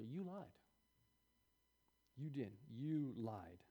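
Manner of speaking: very soft, measured reproach; quiet accusation. Acted. God confronting Sarah about her denial, quiet accusation. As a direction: state the accusation softly, leaving long pauses.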